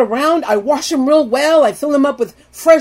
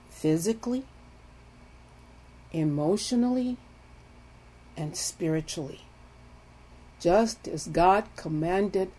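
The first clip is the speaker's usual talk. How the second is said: soft intimate preaching; 5 semitones lower, 9 dB quieter than usual. Acted. Soft slow teaching on intimacy.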